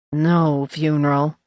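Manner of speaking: slow, glum and heavy. Acted. Janet telling the editor about the funeral, glum and slow. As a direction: drag it out like bad news. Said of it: "sounding unnaturally glum".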